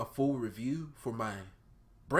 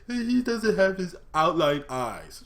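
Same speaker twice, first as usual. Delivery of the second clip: high, loud, slow mocking whine; mocking whiny complainer; 9 semitones higher, 12 dB louder, slower than usual. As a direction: parody a whining fan, drawn out. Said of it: "I'm sick and tired of hearing that".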